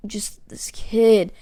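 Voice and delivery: slow exasperated whisper; a slow unvoiced whisper of disbelief. Real, not acted. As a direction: breathe it out, slowly, in disbelief. Exasperated at the toilet breaker.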